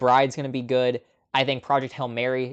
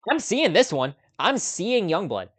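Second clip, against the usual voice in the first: higher, eager decision; a real burst of eagerness, then slowing down; excited; 6 semitones higher than usual. Real, not acted. Decides to see Youngblood.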